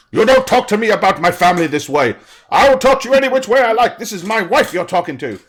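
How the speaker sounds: father shouts at the other man, furious and fast; furious retort. Acted. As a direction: bite back in a rage. Heated quarrel between two men.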